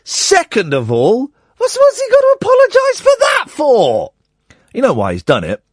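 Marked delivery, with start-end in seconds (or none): 1.6-4.1 squeaky high disbelief; high mock outrage; exasperated. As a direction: throw the question up in frustration. Indignant exasperated question.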